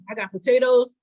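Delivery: after a coughing fit. Real, not acted. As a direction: voice rough from coughing. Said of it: "that's why I'm coughing".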